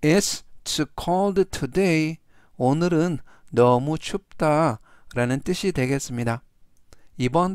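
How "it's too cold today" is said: very slow, careful English reading; slow model sentence. Shown as a demonstration. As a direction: spell out each word slowly for students. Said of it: "전체적 의미는". (아주 천천히 또박또박 읽는 예문)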